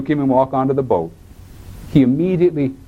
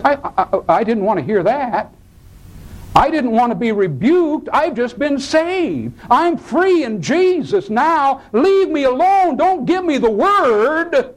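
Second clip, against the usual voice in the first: high-pitched, drawn-out complaining; indignant protest; 13 semitones higher than usual. Acted. New convert objects to the preacher's rebuke, indignant protest. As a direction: protest in a high, hammed-up voice, stretching the words.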